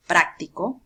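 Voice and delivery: "práctico" with the stress on PRÁC; stress on first syllable. Shown as a demonstration. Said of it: "por la ubicación de la tilde". ("práctico" con fuerza de voz en PRÁC)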